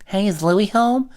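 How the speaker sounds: put-on high, slow kid voice; neighborhood kid. Random kid knocking asks for a friend, high slow voice. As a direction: call it out in a drawn-out childlike pitch.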